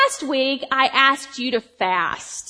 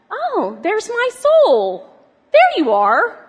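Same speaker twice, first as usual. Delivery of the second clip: delighted discovery; 8 semitones higher, 4 dB louder, slower than usual. Retold or imitated. Greeting her soul with delight.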